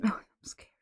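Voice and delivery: whispered mock fright. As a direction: breathe it out scared. Whispered fear.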